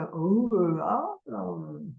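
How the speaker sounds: drawn-out ooh then oh, demonstrating the open vowels; open vowel sounds. Shown as a demonstration. Teacher modelling baby cooing vowels for parents. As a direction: mouth wide, sound "ooh" then "oh" as samples. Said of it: "those are what are wide open vowels".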